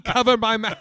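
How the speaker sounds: playing the offended mom, half laughing; mock indignant mom. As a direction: throw the accusation back at him, mocking and loud. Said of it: "she'd be like".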